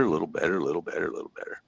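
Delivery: fading away. Acted. Repeats phrase, fading away.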